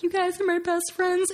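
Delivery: high, put-on sweet voice; imitating tearful gratitude. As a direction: play a touched performer thanking the crowd. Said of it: "he cried at one point, just, like, thanking everyone".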